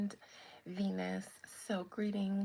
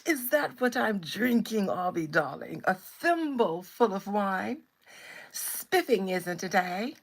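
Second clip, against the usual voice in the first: loud, mock-affronted British caricature; hammed-up British accent with swooping pitch; 7 dB louder than usual. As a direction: play the scandalised Brit for laughs. Continues mock-British wine joke.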